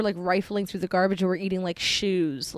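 usual speaking voice

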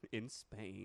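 British accent model phrase; British elocution. Shown as a demonstration. Host leading a mock lesson, British elocution. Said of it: "Now repeat after me".

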